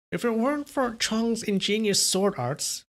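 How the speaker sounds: lightly voiced older woman; female voice. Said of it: "how do I do the female voice again".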